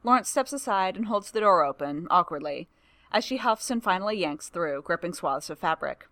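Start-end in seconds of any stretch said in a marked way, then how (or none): none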